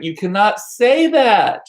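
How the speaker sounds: high, slow mock outrage; scandalized relatives. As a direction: imitate shocked family scolding, stretching each word. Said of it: "everyone, like".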